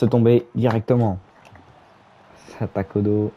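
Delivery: live play-by-play call; wrestling commentator. Acted. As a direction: narrate the match as it happens.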